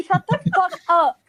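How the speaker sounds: mock snap. Real, not acted. Snapping at friend in jest.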